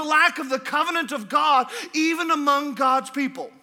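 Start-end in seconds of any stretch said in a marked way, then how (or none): none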